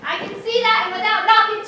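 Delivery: exasperated, loud comeback; indignant scolding. Acted. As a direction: tick off someone who barged in. Lady scolds the noisy intruder.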